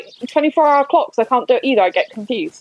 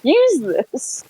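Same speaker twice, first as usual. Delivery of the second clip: put-on excited teen; 8 semitones higher than usual. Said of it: "I was like".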